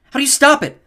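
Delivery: high, loud panicked question; frantic shouted question; yelled. A narrator's reading. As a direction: yell over the chaos. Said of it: "Annabeth yelled".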